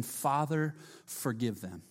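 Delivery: soft, hushed plea from the cross; a hushed, pleading Father forgive them; quiet plea. Acted. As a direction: whisper it like a dying prayer.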